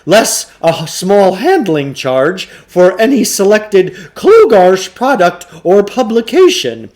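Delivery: Samuel Klugarsh voice. Acted. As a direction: sell it like an oily bookshop owner.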